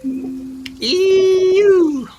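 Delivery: very high, loud squeal of disgust. Real, not acted. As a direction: shriek at the gross mud. Disgust at the silt.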